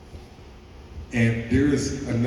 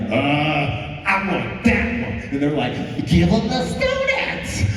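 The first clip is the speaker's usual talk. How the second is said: mock picking; 7 semitones higher, 3 dB louder, slower than usual. Comic mock request.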